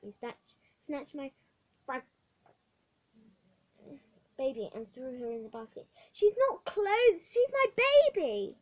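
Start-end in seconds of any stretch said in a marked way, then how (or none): 6.1-8.6 put-on slow, loud, much higher child's protest; girl protesting to the matron. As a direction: defend your doll fiercely. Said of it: "I protested".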